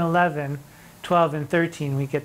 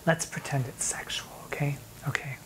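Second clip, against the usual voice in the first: whisper; 2 semitones lower, 9 dB quieter than usual. Real, not acted. Conspiratorial aside to the class.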